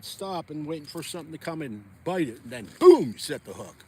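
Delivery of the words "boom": punchy loud burst on BOOM; sudden exclaimed boom. Pretend. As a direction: explode on the word like setting a hook. Acts out setting the hook.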